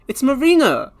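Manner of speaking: high, joyful; light up at the sight; delighted cry. Retold or imitated. Sumeri seeing Marina, delighted.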